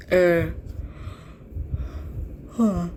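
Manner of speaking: mid-yawn sounds, drawn out. Real, not acted. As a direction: let a real yawn escape. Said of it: "I'm yawning".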